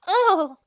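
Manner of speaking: loud disgusted ugh. Real, not acted. Disgusted outburst.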